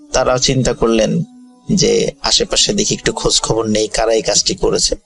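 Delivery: usual speaking voice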